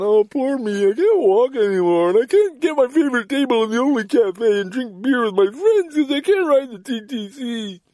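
hammed-up sob story, mocking himself; mock self-pity. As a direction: whine it like someone wallowing. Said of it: "I would never cry to a friend".

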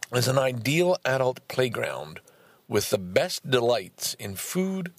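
ordinary delivery

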